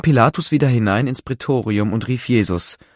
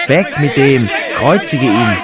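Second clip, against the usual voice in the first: louder, slow furious cry; crowd shouting; 5 dB louder than usual. A narrator's reading. The crowd screams for crucifixion, shouting. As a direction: yell like a raging mob. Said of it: "Sie schrien aber".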